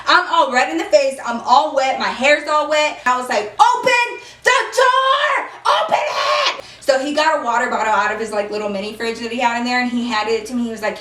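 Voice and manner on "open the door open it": very high, drawn-out yell; screamed demand. Retold or imitated. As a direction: re-enact screaming to be let out. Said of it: "me screaming like that".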